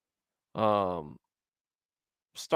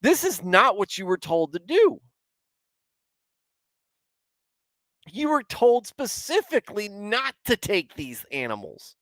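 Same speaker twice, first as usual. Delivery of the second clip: raised, higher voice scolding the king; a prophet calling out disobedience; stern rebuke; 9 semitones higher than usual. Acted. Samuel confronting Saul over the taken animals, stern raised rebuke.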